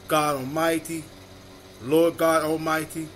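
usual speaking voice

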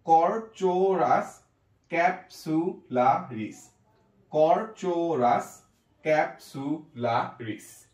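কর্চোরাস ক্যাপসুলারিস said very slowly, every syllable clear; slow full demo. Shown as a demonstration. Teacher joining the syllables for students, slowly. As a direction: put the whole name together slowly. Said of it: "তা কি হলো?".

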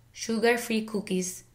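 put-on "sugar-free cookies" slowly in a Filipino accent; Filipino-accented "sugar-free cookies", long pure oo vowels. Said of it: "with a Filipino accent".

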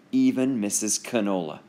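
ordinary delivery

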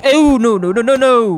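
loud, high run of panicked no's; startled, high and loud protest; real alarm. Real, not acted. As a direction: blurt it out as things go wrong. Alarmed at accidentally going down.

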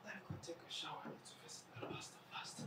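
anxious whispered aside to herself; hushed, urgent whisper. Acted. Alone at dawn, whispering her plan to herself.

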